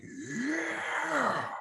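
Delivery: high, drawn-out "yeah" howl; strange heckler yell. Retold or imitated. Heckler yells from the audience, strange high yell. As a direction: imitate a long high yelp from the crowd. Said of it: "yell out, like".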